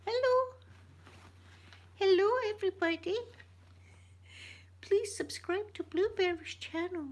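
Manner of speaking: high, squeaky little puppet voice; toy seal voice. Pretend. Seal greeting everybody and plugging the channel, high puppet voice. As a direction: pipe up as a cute seal puppet.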